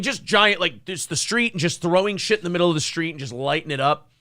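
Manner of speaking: usual speaking voice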